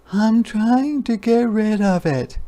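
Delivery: high, sniffy little voice; high sniffing hedgehog. A narrator's reading. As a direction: sniff it, a bit hurt. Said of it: "sniffed Hedgehog".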